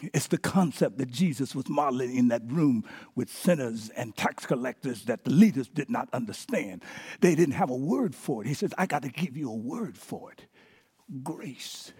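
soft, quiet, gentle fatherly voice, falling to near silence on Grace; tender father. Retold or imitated. Father steps toward his angry son, speaking tenderly and softly. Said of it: "steps forward to the son very tenderly and says".

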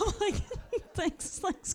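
high, laughing thanks; amused mock thanks to Craig. Real, not acted. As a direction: speak through the giggles. Laughing while retelling.

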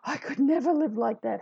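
a hint of superior mock horror; inner narcissist. Inner narcissist looking at them, lightly self-important. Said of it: "allow our little inner narcissist to come out".

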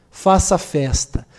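ordinary talk, nothing marked

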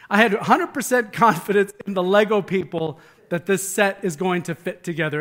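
ordinary talk

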